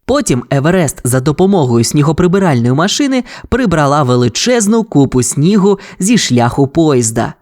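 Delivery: ordinary delivery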